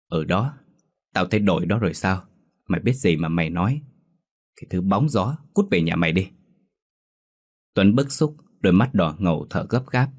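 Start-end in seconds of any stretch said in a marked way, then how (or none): none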